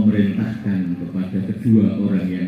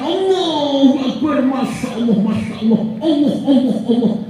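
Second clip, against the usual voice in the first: high, awed calls of Allah; ecstatic cry of awe; 13 semitones higher than usual. Acted. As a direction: cry out in wonder at paradise. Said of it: "orang yang pertama langsung teriak". (teriakan takjub melihat surga)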